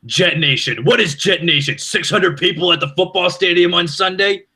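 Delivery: high, mocking New York radio rant; Mike Francesa rant impression. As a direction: play the outraged talk-show host in a big New York accent. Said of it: "he goes".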